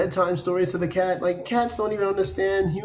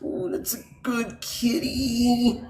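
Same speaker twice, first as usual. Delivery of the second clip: higher, slow sugary cooing; mock cooing; 7 semitones higher, much slower than usual. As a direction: praise the kitty while being clawed, mocking. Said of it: "you're like".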